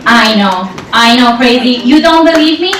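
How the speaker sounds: normal talking voice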